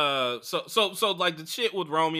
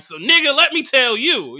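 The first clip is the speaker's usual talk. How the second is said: hyped up to spill everything; fired-up declaration; 9 semitones higher, 11 dB louder than usual. Retold or imitated. On a talkative day, ready to rant, louder and higher.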